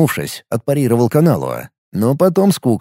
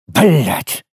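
sudden angry curse, pitched up; explosive shout; 4 semitones higher than usual. A narrator's reading. Narrator erupts at the warrior's fatalism, explosive shout. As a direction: burst out swearing at him. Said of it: "взорвался я криком".

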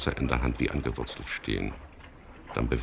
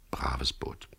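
soft whispered thought to himself; inner thought, whispered; 3 semitones lower, 8 dB quieter than usual. A narrator's reading. Narrator thinking fondly of his boat, whispered. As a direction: breathe it like a private thought. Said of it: "dachte ich".

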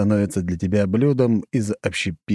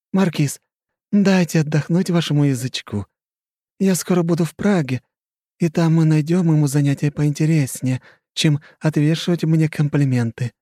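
higher, teasing smirk; smirking countess; 9 semitones higher, 3 dB louder than usual. Acted. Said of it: "усмехнулась Хрущева".

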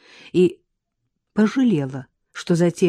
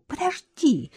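mild cry; 9 semitones higher than usual. Acted. Woman pushing away her lover's hands, mild cry. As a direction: stop him with a quick high cry. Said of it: "закричала она".

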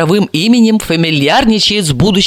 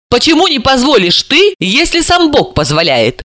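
mild, higher-pitched protest; sincere indignation; 6 semitones higher than usual. A narrator's reading. Daughter challenging her mother, mildly indignant. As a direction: object with a touch of indignation. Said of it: "Серафима искренне возмутилась".

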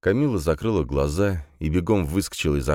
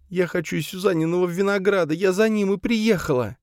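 very high tearful plaint; sobbing. A narrator's reading. Camille wanting Suzanne's grapes, sobbing. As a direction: wail it like someone in tears. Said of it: "всхлипнула девушка".

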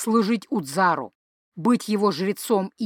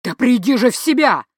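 loud shout, louder; 3 semitones higher, 5 dB louder than usual. A narrator's reading. Ulbern shouting at his son after a slap, loud. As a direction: yell at him to come to his senses. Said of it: "закричал Ульберн".

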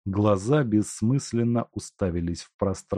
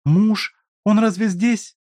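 alarmed; 13 semitones higher, 7 dB louder than usual. Acted. Ганя в испуге спрашивает.

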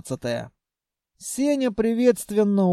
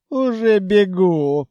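louder, drawn-out sarcastic retort; indignant treasury; 2 semitones higher, 5 dB louder, slower than usual. A narrator's reading. Treasury mockingly refuses the djinn, indignant. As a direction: throw it back loudly and slowly. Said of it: "возмутилась казна".

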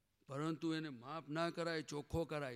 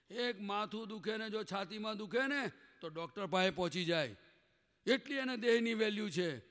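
raised preaching voice; 5 semitones higher, 6 dB louder than usual. Real, not acted. Preacher raising voice on point.

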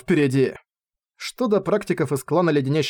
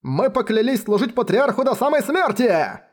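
much higher crowd roar; thunderous roar; 7 semitones higher than usual. A narrator's reading. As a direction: roar the oath together. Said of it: "разразились громогласным криком".

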